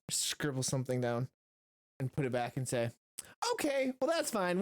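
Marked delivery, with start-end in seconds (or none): 3.5-4.6 suddenly high, light reply; higher, chirpy dismissal; breezy turnaround. Acted. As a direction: brush it off in a high voice. Character switches back to cheer.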